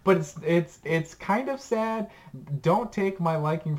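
high-pitched excited rush of agreement. Real, not acted. Recommender to friend who loves sad things, excited. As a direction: pitch jumps way up, thrilled to be matched.

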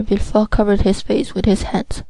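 plain reading voice

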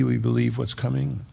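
ordinary delivery

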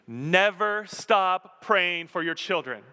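slow, loud, high plea with never punched; loud exhortation. Real, not acted. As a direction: urge the parents with full force. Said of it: "Parents, listen to me".

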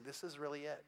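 very soft, hushed inner voice; whisper in the mind. Acted. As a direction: say it hushed, like a thought just dawning. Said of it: "have a whisper in your mind".

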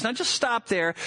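usual speaking voice

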